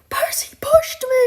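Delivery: high, loud shriek of accusation; screaming. A narrator's reading. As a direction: scream it out, pointing the finger. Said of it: "and screaming".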